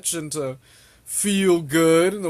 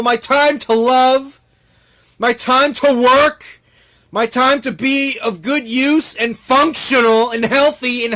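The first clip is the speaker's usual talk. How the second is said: loud, high, furious tirade; a full-voiced angry rant, building to shouts; angry shouting rant; 7 semitones higher, 8 dB louder than usual. Real, not acted. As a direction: yell it out, fed up with chasing money. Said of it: "That just annoys me".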